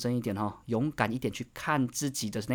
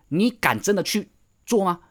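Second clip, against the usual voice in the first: usual speaking voice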